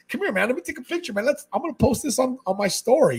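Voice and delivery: high-pitched, excited coaxing; eager invitation. Acted. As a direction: wave a fan over for a photo, all enthusiasm. Acting himself eagerly asking for picture.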